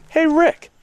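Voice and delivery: high, laughing offer; mock friend offering. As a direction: tempt him to taste it. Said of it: "Taco says".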